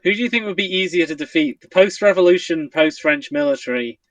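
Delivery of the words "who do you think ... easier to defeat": ordinary talk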